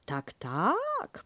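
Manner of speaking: pretending to take him seriously; mock serious. Kanga humoring Piglet, mock serious. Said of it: "niby to na serio".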